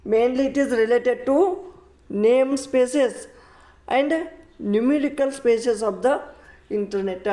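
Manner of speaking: very slow, word by word definition; slow textbook reading. Shown as a demonstration. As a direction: dictate the definition for notes. Teacher reads definition aloud.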